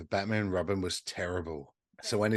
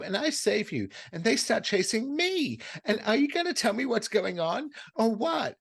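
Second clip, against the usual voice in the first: Cindy's rising rant; 11 semitones higher than usual. Retold or imitated. Retelling Cindy's rant at the man who kidnapped her, high and exasperated. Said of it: "So she goes".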